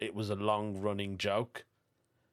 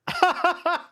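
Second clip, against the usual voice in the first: very high, loud staccato cackle; high-pitched laugh; 8 dB louder than usual. Real, not acted. Host amused by listener practising the accent in a car, high-pitched laugh. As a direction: shriek with laughter in short bursts.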